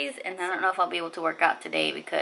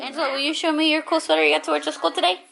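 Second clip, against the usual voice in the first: normal talking voice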